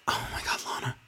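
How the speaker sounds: whispered under breath. Real, not acted. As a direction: whisper it to yourself.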